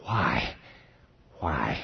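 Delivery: low, soft, fading "why"; a sufferer groaning out of pain, dropping away; understated groan of a sick man; agonized groan. Retold or imitated.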